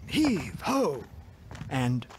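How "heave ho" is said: heave-ho effort. A narrator's reading. Heaving the ogre overboard.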